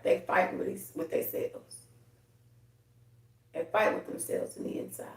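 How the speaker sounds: dropped to a whisper; hushed. Real, not acted. Whispering to viewers about people's inner struggle. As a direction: confide it under the breath.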